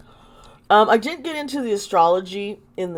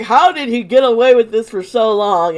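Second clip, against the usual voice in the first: loud, outraged exclaim; 5 semitones higher, 11 dB louder than usual. Retold or imitated. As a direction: exclaim it loudly in disbelief. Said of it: "I was like".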